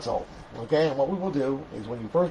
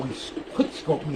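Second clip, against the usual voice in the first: ordinary talk, nothing marked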